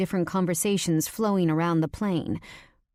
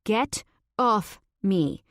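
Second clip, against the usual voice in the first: slow, deliberate get off me with a higher pitch, off drawn out and falling; forced firm command; 4 semitones higher, much slower than usual. Acted. Pinned beneath him, she slowly forces out her demand. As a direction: push out "Get off me" slowly, every ounce of strength behind it. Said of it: "With all the strength I can muster".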